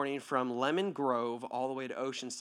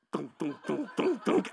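glugging noise. Retold or imitated. Imitating water glugging out.

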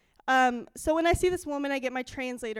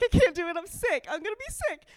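panicked; 6 semitones higher, 5 dB louder, faster than usual. Retold or imitated. Panicked retelling of feeling sick.

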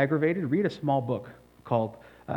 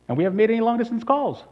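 mock outraged complaint; 9 semitones higher, 5 dB louder, faster than usual. Mock complaint about phone bill.

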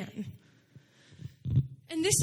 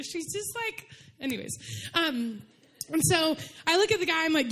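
ordinary talk, nothing marked

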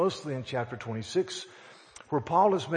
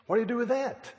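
mock helpless, high-pitched question; imitating a stumped official throwing up his hands; acted bafflement; 9 semitones higher, faster than usual. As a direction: toss it up high, as if at a loss. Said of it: "He kind of threw up his hands".

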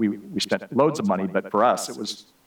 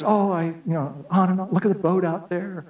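higher, lightly voiced delighted wife; gushing wife; 7 semitones higher than usual. Wife ignoring her sulking husband, mock delighted and higher. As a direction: gush over the view like she did.

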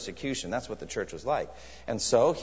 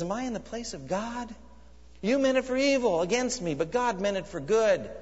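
Joseph's gentle words; 7 semitones higher than usual. Acted. Joseph forgives his brothers.

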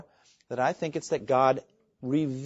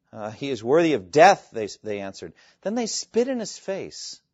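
scripture read aloud; 3 semitones higher, 7 dB louder than usual. Acted. Preacher to congregation, dramatic scripture reading. Sermon reads the trial scene.

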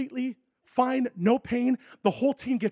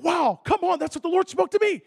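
higher and louder, thrilled; excited retold reply; 9 semitones higher, 6 dB louder than usual. Preacher reacting to his friend's news, thrilled. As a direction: react with joy.

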